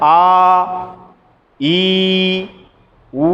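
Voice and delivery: आ, ई, ऊ each held two beats; long vowels held. Shown as a demonstration. Teacher to class, demonstrating long vowels, drawn out. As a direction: draw out आ, ई, ऊ evenly. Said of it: "जरा देखेंगे".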